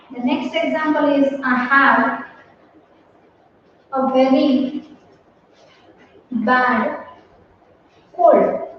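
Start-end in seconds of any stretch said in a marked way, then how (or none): 1.4-8.6 slow, word by word, like dictating to a class; dictation pace. Shown as a demonstration. Teacher dictating an example sentence, slow. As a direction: spell it out slowly for the students to copy.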